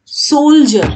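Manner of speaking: "सोल्जर" slowly as SOHL-jer for repeating, high then falling; pronunciation model. Shown as a demonstration. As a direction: stretch the SOHL in "सोल्जर", let jer drop. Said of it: "बोलो".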